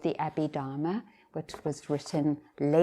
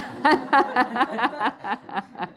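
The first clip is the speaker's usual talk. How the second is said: loud, high peal of laughter; long real belly laugh tailing off; hearty laugh; 7 semitones higher, 6 dB louder than usual. Real, not acted. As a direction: burst out laughing at your own answer. Laughs at own blunt answer.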